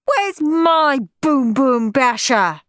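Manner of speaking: loud slow outraged cry; screamed. Acted. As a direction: demand it at the top of lungs. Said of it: "screamed Steve".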